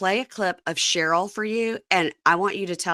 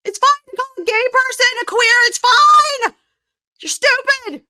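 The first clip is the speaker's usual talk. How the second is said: shrill-high, loud, dismissive caricature; mocking Kelly Dodd; 10 dB louder than usual. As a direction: sneer it like an influencer defending herself. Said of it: "She'll be on her page going".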